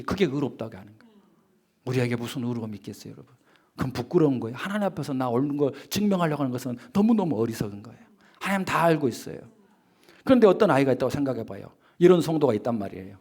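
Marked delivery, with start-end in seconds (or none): none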